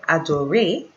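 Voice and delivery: "adorer" ending in an "ay" sound; French "adorer" with a silent final R. Shown as a demonstration. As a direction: say the end of "adorer" like English "ay", rising. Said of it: "like A-Y".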